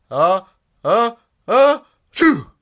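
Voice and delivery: high, loud, slow sneeze windup; a drawn-out exaggerated achoo; mock sneeze. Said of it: "replied the fans".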